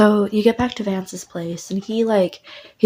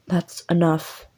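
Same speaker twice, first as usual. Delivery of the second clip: ordinary delivery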